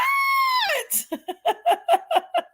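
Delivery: very high, squealing burst of laughter; shrieking laugh. Real, not acted. Laughing after yelling at Dynamite, high shrieking laugh.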